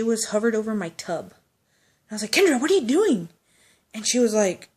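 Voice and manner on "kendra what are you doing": loud, high, baffled; startled question. Retold or imitated. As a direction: call out in disbelief. Friend hunched over the tub.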